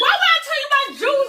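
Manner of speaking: very high, loud mock outrage; indignant comeback. He answers the juice question, in loud indignation. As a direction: act out an exasperated yelp.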